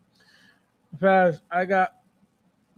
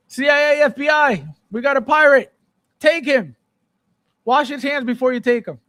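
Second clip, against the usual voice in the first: loud, high mock call to arrest him; mock alarm call; 6 semitones higher, 5 dB louder than usual. Streamer jokingly calls the feds on a pirating chatter, loud mock alarm. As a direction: bark for the feds, hammed up.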